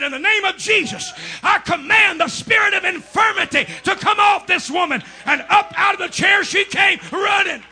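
shouted, hammering preacher cadence; fiery revival preacher shouting; preaching at full force. Acted. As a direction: drive it home to the congregation.

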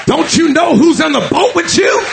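climbing sermon cry; rising preached shout. Acted. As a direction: drive the question home like a challenge. Jesus repeats question, second louder.

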